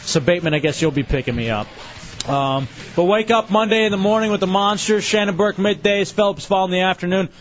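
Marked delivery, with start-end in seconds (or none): 2.9-7.3 upbeat show outro read; radio announcer. Acted. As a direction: plug the lineup like a DJ.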